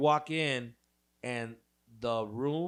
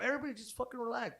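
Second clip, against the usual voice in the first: mock calming; 8 semitones higher, 3 dB quieter, faster than usual. Talking the boyfriend down.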